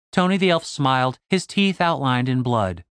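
ordinary delivery, nothing marked

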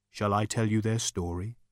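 lightly voiced ethereal speaker; a hint of a calm, bodiless spirit; quiet inquiry. A narrator's reading. Voice offering Zaphod a story, quiet inquiry.

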